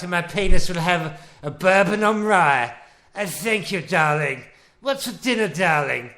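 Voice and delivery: a hammed-up plummy 1940s husband ordering his wife about; old British film husband. Husband to wife after work, hammed-up posh old-film gent. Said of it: "like the old British films".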